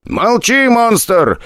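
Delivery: high sharp shout; barked order. A narrator's reading. Brigade head snapping at Silikona, sharp and high. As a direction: snap at the prisoner. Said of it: "прикрикнул на неё".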